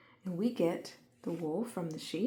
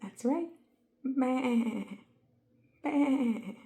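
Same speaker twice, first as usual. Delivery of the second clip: high bleating sheep; 6 semitones higher, 5 dB louder, much slower than usual. Acted. Teacher speaking as the happy sheep, bleating. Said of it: "The sheep says".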